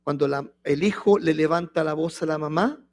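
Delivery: normal talking voice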